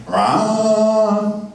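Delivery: a sudden high, loud mock "rah!"; imitated scream. Producer showing the band the voice he hears in the demo, high mock yell. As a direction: belt out a short wordless yell, pitched high. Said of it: "Somebody going".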